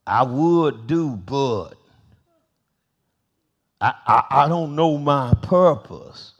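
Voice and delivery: slow, hesitant mock excuse-maker. Preacher mocking idle folk, slow hesitant excuse. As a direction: drag it out like a lazy excuse. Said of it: "Those folk that just sit there".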